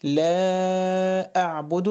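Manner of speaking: slow recitation, stretching لا well out; separated madd. Shown as a demonstration. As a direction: hold the vowel of لا very long before أعبد. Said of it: "برضه مد منفصل أهو". (مدّ طويل في لا قبل أعبد)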